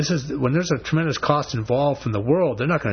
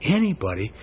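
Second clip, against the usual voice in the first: higher-pitched incredulous question, then settling on the repeat; acted disbelief; 5 semitones higher than usual. Preacher shaking his head at those who dismiss Jesus, acted disbelief. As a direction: ask it in head-shaking bewilderment. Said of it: "I shake my head and say".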